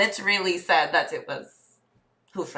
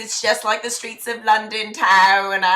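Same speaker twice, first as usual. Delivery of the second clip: put-on British accent. Passenger likening the drive to London, British accent. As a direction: marvel at the streets in a British accent. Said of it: "Because we're both British".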